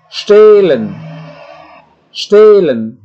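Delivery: Stehlen with a long E, loud and high. Shown as a demonstration. Teacher models the long E to class. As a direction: stretch the E in Stehlen. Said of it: "untuk memanjangkan vokal".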